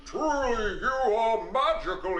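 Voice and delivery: a lightly voiced king, amazed at the gold; king's voice. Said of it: "That's his voice".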